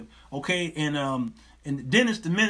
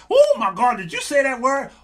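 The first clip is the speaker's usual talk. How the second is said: loud, high-pitched mock astonishment; amazed reaction; 7 semitones higher, 6 dB louder than usual. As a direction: blurt it out, wowed by the teacher. Acting out excited live reaction to teacher.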